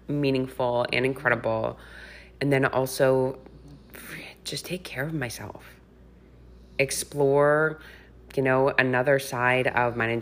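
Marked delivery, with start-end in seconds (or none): 4.4-5.6 whispering. Real, not acted. Aside about self-care.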